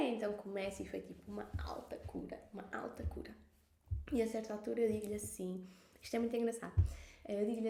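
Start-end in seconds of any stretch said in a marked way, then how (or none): 0.1-3.3 whispered, breathy with awe; hushed awe. Real, not acted. Telling the host about the healing, in an awed whisper. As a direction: whisper it like a secret marvel.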